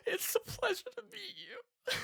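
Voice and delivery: high, weepy half-laughing voice; crying Kurt. Acted. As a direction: squeak it out through happy tears. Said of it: "Kurt will put a hand out".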